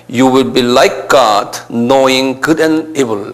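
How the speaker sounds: "You will be like God" read word by word, very slow; English reading very slowly. Shown as a demonstration. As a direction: read the English verse syllable by syllable. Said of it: "영어로는 그렇게 돼 있어요". (영어 구절을 아주 천천히 또박또박 읽기)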